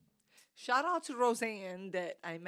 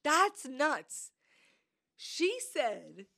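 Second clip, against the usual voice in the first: ordinary talk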